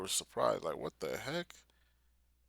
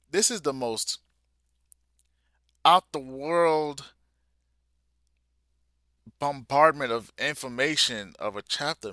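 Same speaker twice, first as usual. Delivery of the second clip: much higher and louder, stretching "out the world"; overwhelmed exclamation; 5 semitones higher, 10 dB louder than usual. Real, not acted. Reader overwhelmed by the chapter's twists, loud stunned outburst. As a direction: yell in stunned disbelief.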